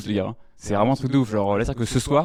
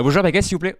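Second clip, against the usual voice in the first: higher, louder rushed retry of the order; nervous retry; 6 semitones higher, 4 dB louder than usual. Retold or imitated. Comic tries the baker again, tense and high. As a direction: panicky second attempt at the bakery.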